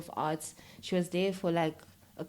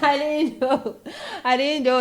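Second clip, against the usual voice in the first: laughing admission; 8 semitones higher, 8 dB louder than usual. Real, not acted.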